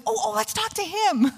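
high, excited imitation of an interviewer; retold eager host. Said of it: "And Ellen says".

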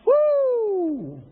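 high, loud whoop of delight; hammed-up farmer's whoop; joyful whoop. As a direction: cheer like a happy farmer. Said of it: "Farmer's like".

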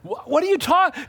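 ordinary delivery

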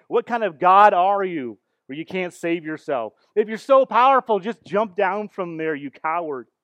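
loud, high jeering taunt; mocking crowd yell. Preacher voicing the mob taunting Jesus, loud jeer. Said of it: "They're yelling things at him like".

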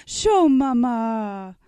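hammed-up baby talk to rouse tears; pitiful compassionate voice. Cousin baiting his infant brother, mock pity. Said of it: "in a pitiful, compassionate voice".

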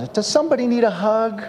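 high, goofy overeager elf voice; Buddy the Elf. Retold or imitated. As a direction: pitch way up like a giddy elf offering hugs. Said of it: "you know, Buddy the Elf".

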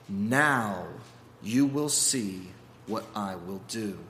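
calm him down gently; kindly God. Acted. God reassures Moses, low and kind. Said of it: "God responds kindly".

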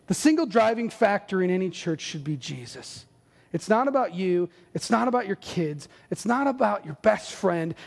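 normal talking voice

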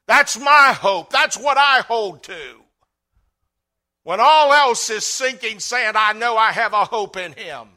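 loud, rising preacher's cry; preaching at full force. Acted. Pastor preaching loud to his congregation.

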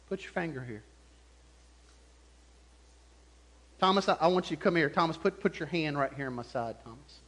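soft, low, gentle invitation; gracious, merciful. Retold or imitated. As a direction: coax the doubter closer tenderly. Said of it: "And in a gracious, merciful way".

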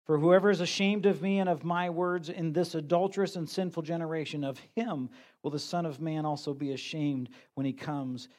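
a pastor reading the gospel aloud from the pulpit; scripture reading. Acted. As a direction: read the passage out to the congregation. Reads Mark 8 aloud.

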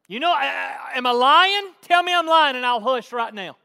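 loud, high preaching burst. Real, not acted. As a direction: challenge the congregation at full volume. Full-force preaching burst.

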